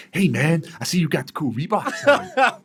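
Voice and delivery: perky, high-pitched American impression; Sage Northcutt. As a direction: chirp it like an over-eager American. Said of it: "You're, you're too perky".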